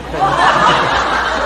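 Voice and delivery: 그래갖고 squeezed out in a high, breathless squeal of laughter; laughing squeal. Real, not acted. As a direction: laugh it out at your own slip, very slow. Laughs at own slip. (그래갖고를 높은 웃음소리로 길게 끌며)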